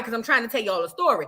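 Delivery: ordinary talk, nothing marked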